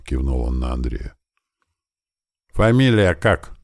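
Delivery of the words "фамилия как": slow, raised-pitch demand; loud question. A narrator's reading. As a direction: call out the question, drawn out. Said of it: "громко спросил Андрей". (громко, протяжно спросить)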